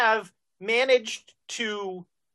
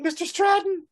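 higher, anxious call; calling out for the boss, worried; a guy next door calling the boss's name, anxious; 10 semitones higher than usual. Acted. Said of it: "you just hear a guy saying".

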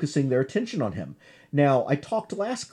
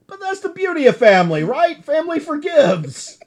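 friendly brother; 13 semitones higher, 6 dB louder than usual. Retold or imitated. Voicing Saber, the friendly brother.

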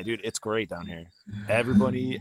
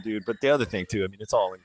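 ordinary delivery

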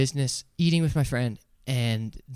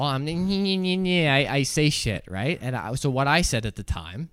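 usual speaking voice

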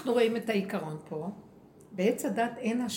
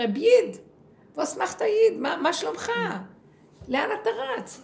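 much higher, loud friendly call to a passerby; rabbi calling out; 9 semitones higher, 5 dB louder than usual. Retold or imitated. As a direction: hail a hurrying man warmly. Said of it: "אז הוא אומר".

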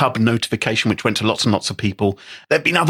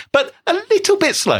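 teasing understatement. Real, not acted. Teasing understatement about the fix.

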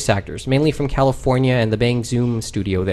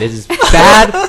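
slow, laughing, pitched up; laughing, drawn out; 6 semitones higher, 6 dB louder, much slower than usual. Real, not acted. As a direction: stretch it out while laughing.

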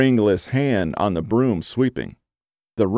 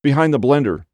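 higher, louder sudden outburst; blurted out; 6 semitones higher, 4 dB louder than usual. Acted. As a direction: blurt it out as it hits you. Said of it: "Marie blurted".

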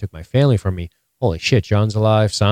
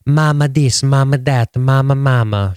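louder, higher mock whine of mama; ridiculing the Sand Snakes' dialogue, a touch louder; mocking Sand Snakes; 5 semitones higher, 3 dB louder than usual. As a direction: parrot their nagging mamas.

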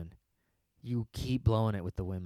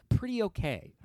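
mock shrug; 11 semitones higher, 3 dB louder than usual. Mock definition, high.

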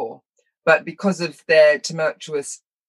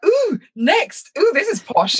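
much higher, impressed oohing; mock awed excitement; 12 semitones higher, slower than usual. Child taken by father to posh shop, delighted awe. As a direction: squeal it lightly like a dazzled child.

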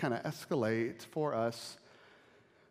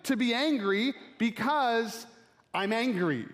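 higher, slow, petulant; mock petulant Jonah; 8 semitones higher, 4 dB louder, slower than usual. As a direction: whine it like a sulky prophet. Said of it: "Jonah says".

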